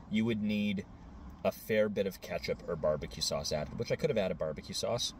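ordinary delivery

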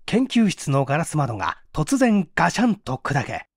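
plain reading voice

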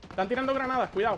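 high urgent warning cry; grenade warning yell. Real, not acted. Grenades incoming.

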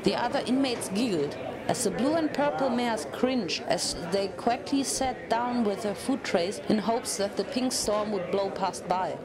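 plain reading voice

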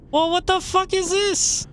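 high, incredulous disbelief; what-the-heck outrage at the game; exasperated. Real, not acted. Blocked path in horror game.

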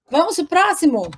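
normal talking voice

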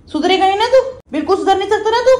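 high, loud scolding of the pet; mock-angry mother scolding her pet. Real, not acted. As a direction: tell the naughty pet off sharply.

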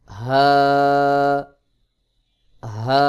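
হা breathed from the start of the throat, vowel held; loud, drawn-out হা. Shown as a demonstration. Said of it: "হলকের শুরু থেকে".

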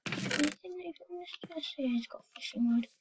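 rapid unvoiced whisper; fast whisper. Real, not acted. Gamer whispering loot finds to viewers. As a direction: rattle it off in a whisper. Checking a chest.

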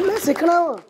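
high-pitched imitation of a keen pupil; high, insistent retold answer; mock eager insistence. Pupil insists, high voice amid laughter.

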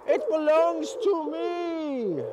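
high, drawn-out claim; excited little ghost. Acted. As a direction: squeal it slowly in ghostly excitement.

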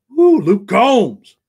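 a loud, high, slow concert whoop; excited whoop. Real, not acted. Streamer whoops for [name], his favourite country singer, when he mentions him. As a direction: holler it like you're front row at the show.

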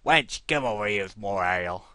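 loud gruff ruffian bellow; rough bandit. Acted. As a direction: bark it across the tavern like a thug. Ruffian yells to barmaid.

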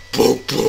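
mock pounding heartbeat sound; imitated heartbeat. Player imagining ten hearts beating at once, imitated heartbeat.

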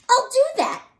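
very high, loud screech. A narrator's reading. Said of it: "screeched the grouchy ladybug".

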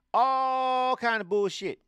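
high, loud, slow burst of outrage; indignant outburst. Real, not acted. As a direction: drag out the disgust, loud and high. Ranting about Thanksgiving history.

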